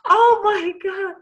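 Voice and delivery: high, breathless overwhelmed cry; stunned and moved; overwhelmed gasp. Real, not acted. Overwhelmed after poem.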